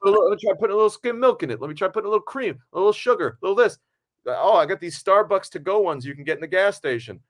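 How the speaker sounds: high, quick, laughing eager voice; eager coffee convert. Retold or imitated. Wrestler discovering coffee flavors, giddy and high. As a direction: play a new fan giddy about every option.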